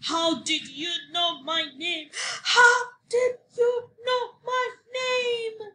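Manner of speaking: high, slow screaming rage; the angry little man screaming at Sophie; furious shout. A narrator's reading. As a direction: build to a shrieking scream. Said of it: "He screamed and shouted and stamped his feet".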